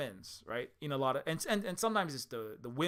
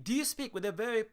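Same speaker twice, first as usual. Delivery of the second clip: badly faked posh British accent, higher pitch; terrible British accent; 7 semitones higher than usual. Shown as a demonstration. Asking how you speak, in a bad posh British accent. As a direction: have a clumsy go at posh British. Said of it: "I know that's a terrible one".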